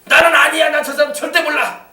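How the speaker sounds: high, loud vehement shout; cursing denial. Acted. Peter disowning Jesus with curses, shouting vehemently. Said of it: "저주를 하면서".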